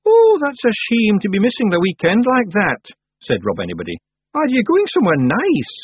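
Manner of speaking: loud, high Scots character voice; high, boisterous Feegle; cheeky Feegle. Acted. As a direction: pipe up loudly as a cheery little Scot. Rob Anybody's cheeky reply.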